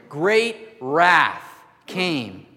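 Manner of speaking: "great wrath came" very slow, loud and high; heavy emphasis. Real, not acted. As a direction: hammer each word of "great wrath came", drawn out. Preacher stresses the verse's wrath.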